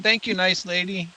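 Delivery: a hint of a grateful little boy; lightly voiced little boy. Little boy thanking the lady who promised help, lightly voiced.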